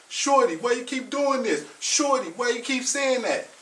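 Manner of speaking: imitating an annoyed young man scolding shorty; frustrated young man. Frustrated young man snapping at girlfriend.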